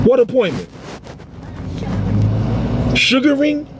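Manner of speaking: high, breezy pretend phone chat; mock phone call. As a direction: toss off a fake call in a high voice. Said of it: "I was so rudely interrupted".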